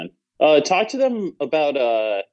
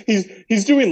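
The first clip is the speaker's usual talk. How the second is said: ordinary talk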